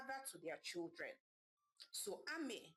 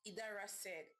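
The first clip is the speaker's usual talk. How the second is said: ordinary delivery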